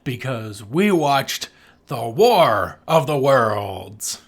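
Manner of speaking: ordinary talk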